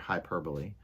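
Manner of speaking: usual speaking voice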